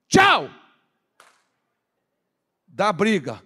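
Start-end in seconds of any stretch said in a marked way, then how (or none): 0.1-0.5 high, loud, emphatic goodbye; shouted send-off. Acted. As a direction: shout them out of the house. Dismissing married children from home. (tchau gritado, despachando)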